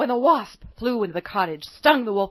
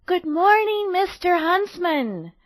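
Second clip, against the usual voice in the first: put-on high, louder cheerful girl greeting; bright greeting; 8 semitones higher, 4 dB louder, slower than usual. A narrator's reading. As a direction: call out a cheerful hello.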